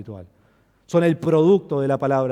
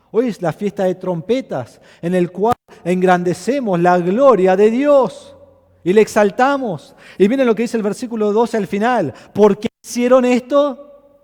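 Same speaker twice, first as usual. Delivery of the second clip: proclama alto, con fuerza de púlpito; predicación enérgica; 6 semitones higher, 9 dB louder than usual. Acted. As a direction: predica con fervor a la congregación. Preaching at full force. (predicador en pleno fervor ante la iglesia, voz fuerte)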